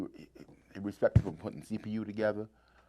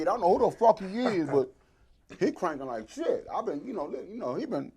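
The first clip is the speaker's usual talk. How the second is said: lightly raised, lively Boogie voice; imitating Boogie; 7 semitones higher, 6 dB louder than usual. Boogie in the car raving about a young player, lightly raised voice. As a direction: gush about the youngin' in a higher voice.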